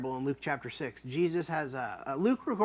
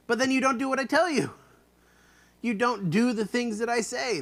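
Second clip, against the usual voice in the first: higher, faster, exasperated; indignant rebuke; 7 semitones higher, 4 dB louder than usual. Shown as a demonstration. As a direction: snap it out. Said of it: "the insanity of it".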